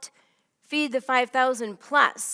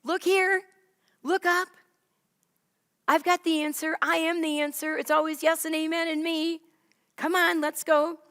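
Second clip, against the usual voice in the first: Jesus encouraging; 5 semitones higher than usual. Acted. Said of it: "He's like".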